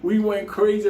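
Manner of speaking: usual speaking voice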